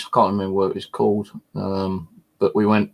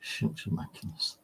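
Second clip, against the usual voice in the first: mock whisper; 16 dB quieter than usual. Echoing the grand nickname.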